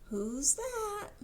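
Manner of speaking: ordinary delivery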